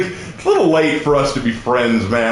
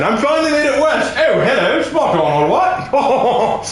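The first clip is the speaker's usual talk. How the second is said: put-on higher, caricature British accent; mock British accent; 9 semitones higher than usual. Sun Wukong arriving in Europe, hammed-up British accent. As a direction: ham up a cheery Brit, drawing out the last words. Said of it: "How was my British accent?".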